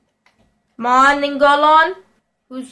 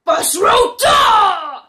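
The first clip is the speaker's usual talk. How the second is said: long, high-pitched shout; yelled name; 5 semitones higher, much slower than usual. Real, not acted. Player yells at footballer in game, long shout. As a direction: bellow the name, stretching it right out.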